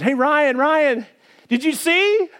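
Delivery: a neighbor bursting with news; excited neighbor. Retold or imitated.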